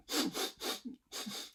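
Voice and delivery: a quick breathy sniff; sniffle. Shown as a demonstration. Teacher acting out the flea's sniffle for kids. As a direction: sniff like a stuffy little flea. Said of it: "when he went".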